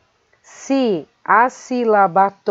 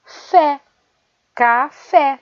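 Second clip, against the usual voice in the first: "fé" alone and higher, then "café" with FÉ marked; stress on FÉ; 4 semitones higher, slower than usual. Shown as a demonstration. Teacher showing the stronger syllable, stress on FÉ. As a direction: isolate "fé", then say "café" leaning on its ending. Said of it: "A sílaba que é pronunciada com mais força".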